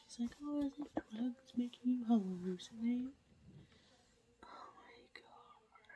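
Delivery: hushed late-night whisper to the camera; whispered update. Real, not acted. Vlogger whispering a reading update at night, hushed whisper. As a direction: keep it all breathy and hushed so nobody wakes.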